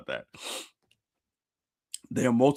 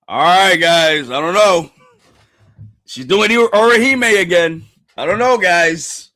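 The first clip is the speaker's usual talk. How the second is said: raised, high, loud worry; loud apprehension; 5 semitones higher, 11 dB louder than usual. Real, not acted. Streamer doubts her risky move, loudly apprehensive. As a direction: call out your doubts, stretched and loud.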